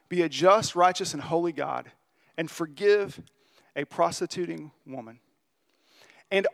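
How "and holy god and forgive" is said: normal talking voice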